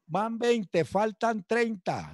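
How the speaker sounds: high, slow count from a sergeant dragging out the ordeal. Retold or imitated. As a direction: stretch the count out to make them suffer. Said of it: "empezaban a contar que era lo terrible".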